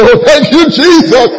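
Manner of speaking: lightly voiced fervent brother; excited praise. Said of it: "he says".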